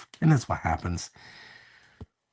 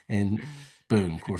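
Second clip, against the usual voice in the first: normal talking voice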